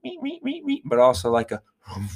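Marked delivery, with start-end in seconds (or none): 0.0-0.6 high soft squeaky reets; beaver squeak. Pretend. Host imitating a beaver, high soft squeaks. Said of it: "makes, like, a reet".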